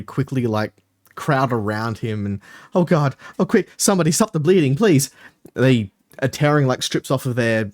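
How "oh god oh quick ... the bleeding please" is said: high, urgent begging gnome; panicked plea. Acted. Gnome pleading with friends over bleeding wrist, panicked. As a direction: beg them to stop the bleeding.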